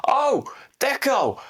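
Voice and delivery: high, slow, stretched-out holler; imitated bawling at a player; mock manager's yell. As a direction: shout it slowly down the pitch, pitched right up.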